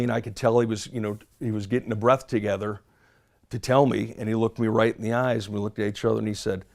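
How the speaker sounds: normal talking voice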